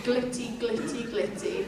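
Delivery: very quietly. Shown as a demonstration. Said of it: "Very quietly".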